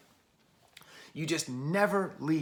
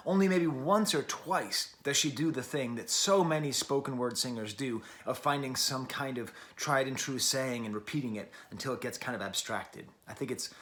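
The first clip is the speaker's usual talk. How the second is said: ordinary talk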